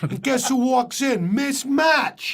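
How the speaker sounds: broad Boston delivery, words stretched for comic effect; Boston accent. Acted. Telling a bar story about unpronounced R's, Boston accent. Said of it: "there's Boston. ... they don't pronounce the letter".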